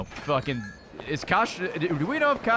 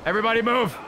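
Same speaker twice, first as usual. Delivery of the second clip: raised, higher-pitched order; louder commanding call; 5 semitones higher, 4 dB louder, faster than usual. Pretend. Streamer orders everybody to move, raised commanding shout. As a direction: call it out to the whole room.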